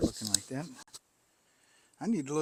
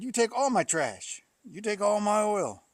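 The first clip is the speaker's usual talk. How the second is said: louder, higher retold protest; indignant retort; 8 semitones higher, 6 dB louder than usual. Resident complains to worker, raised indignant voice. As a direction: push back hard at the worker.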